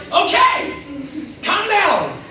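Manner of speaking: high-pitched plea to back off; exasperated protest. Acted. Son pushing away his kissing father, exasperated. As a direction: push him away with a high, drawn-out protest.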